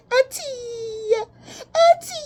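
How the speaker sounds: squealing Auntie at the cards; excited cry of Auntie. Real, not acted. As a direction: shriek Auntie in disbelief. Calling out at the crying person.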